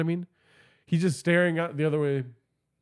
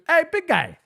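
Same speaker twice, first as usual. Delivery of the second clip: very high, louder coo; flirty falsetto; 12 semitones higher, 4 dB louder than usual. Retold or imitated. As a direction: croon it up high to woo him. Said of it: "I started calling him daddy".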